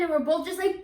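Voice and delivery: ordinary talk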